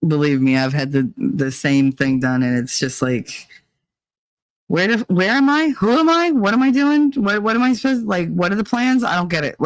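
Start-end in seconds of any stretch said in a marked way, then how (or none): 4.7-9.6 high, rattled string of questions; mock bewildered panic. Commiserating over having no idea where she's at, mock frantic. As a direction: fire off questions as if totally lost. Acting out confusion.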